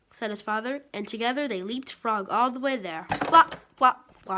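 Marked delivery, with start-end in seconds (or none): 3.3-4.4 loud bouncy flops; flopping sound. A narrator's reading. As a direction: hop them out. Leapfrogging sound.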